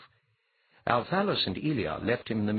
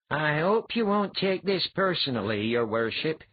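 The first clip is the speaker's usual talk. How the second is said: mock-polite apology; 8 semitones higher, 4 dB louder than usual. A narrator's reading. Apologizes politely before stabbing.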